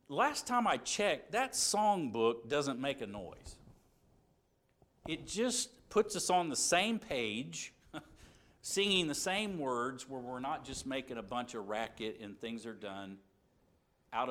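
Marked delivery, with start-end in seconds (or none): 0.1-10.1 slow, louder, emphatic preaching; wry, slow sermon emphasis. Real, not acted. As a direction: drive the point home from the pulpit. Preacher drives home point.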